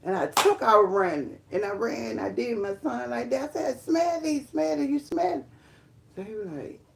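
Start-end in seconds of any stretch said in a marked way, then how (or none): 3.8-5.4 high-pitched, eager demand; excited urging. Retold or imitated. Urges son to smell clothes.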